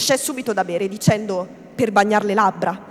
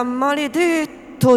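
higher, drawn-out curse; curse to oneself; 5 semitones higher, much slower than usual. A narrator's reading. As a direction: draw the oath out, higher in pitch. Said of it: "disse tra sé". (imprecazione tra sé, lenta e più acuta)

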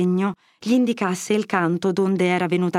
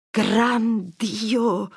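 exclaiming; 3 semitones higher than usual. A narrator's reading. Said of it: "sclamò a quel punto".

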